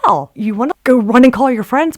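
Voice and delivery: high, loud exclaimed disbelief; incredulous. Retold or imitated. Doubting you were ever on that mountain, incredulous. Disbelief at having been fooled.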